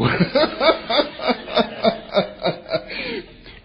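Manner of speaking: loud high burst of laughter; real laugh. Real, not acted. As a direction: laugh out at the joke. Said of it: "Okay, I'm getting ahead of myself".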